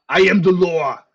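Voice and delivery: loud commanding proclamation; booming declaration. Acted. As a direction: bellow it like a stern judge. Declaring voice of authority.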